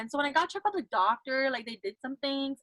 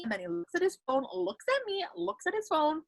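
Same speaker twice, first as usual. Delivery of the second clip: ordinary delivery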